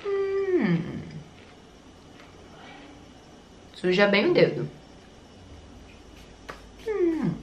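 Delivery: talking while chewing; chewing food. Real, not acted. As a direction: mouth partly full. Seen 0: Ate chip at -7; lips pursed, jaw working through labelled words.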